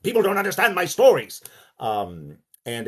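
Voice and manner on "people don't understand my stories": loud, high, projected complaint; stage shouting. Acted. Lovecraft shouting at Sonia, projected stage shout. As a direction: project it to the back of the theatre. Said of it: "shouting at Sonia about".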